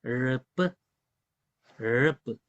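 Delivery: teacher's alternate accent demo; other accent variant. Shown as a demonstration. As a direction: model the variant pronunciation. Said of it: "Depende del acento".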